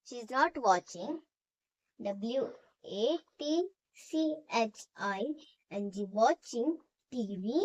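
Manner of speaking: very slow, word by word, for students to write; slow dictation. Shown as a demonstration. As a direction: spell it out slowly so the class can copy. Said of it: "dictation and spelling".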